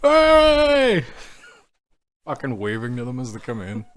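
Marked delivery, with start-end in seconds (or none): 0.0-1.1 high, drawn-out hey; calling out a greeting. Acted. Waving to them as they come in, calling out. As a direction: hail them with a long, high-pitched call.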